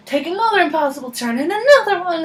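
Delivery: high, exaggerated sing-song directions; mock directions voice. Navigation bossing a panicked driver, mock directions voice. Mocking impossible driving directions.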